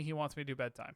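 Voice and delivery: ordinary delivery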